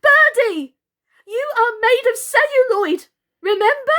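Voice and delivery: very high, loud pleading shout; urgent warning. Acted. Doll shouting to warn Birdie of fire, desperate.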